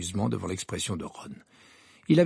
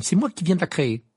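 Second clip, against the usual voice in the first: higher, louder, brisk retort; curt Hermione; 6 semitones higher, 5 dB louder than usual. A narrator's reading. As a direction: state it curtly, with pride. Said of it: "répondit sèchement Hermione". (ton sec et assuré)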